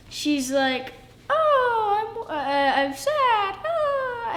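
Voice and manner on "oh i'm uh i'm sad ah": higher, hammed-up mock sob; mock crying. Kid acting Mary sad at the empty tomb, mock crying. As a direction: play Mary's grief as a kid's put-on wail.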